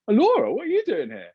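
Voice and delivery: a sudden delighted surprise at spotting her; surprised greeting. Retold or imitated. Spotting Laura in the lobby, high surprised greeting. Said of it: "I was like".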